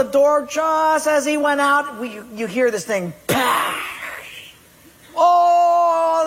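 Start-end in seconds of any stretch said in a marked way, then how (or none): 3.3-3.9 crash noise. Retold or imitated. Watch smashing in joke. 5.2-6.2 high, loud burst of oh; startled oh. Acted. Portuguese guy reacting to the smashed watch, loud high oh.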